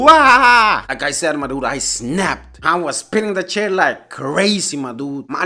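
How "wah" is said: loud, high-pitched wah; imitated crying wail. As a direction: bawl like a little sister in mock terror. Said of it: "turned into".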